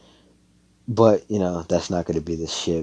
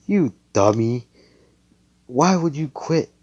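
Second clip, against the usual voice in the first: scolding yell; 8 semitones higher, 6 dB louder than usual. Retold or imitated. Magnus scolding Noelle.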